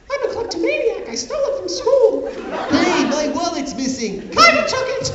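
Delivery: very high squeaky voice. Acted.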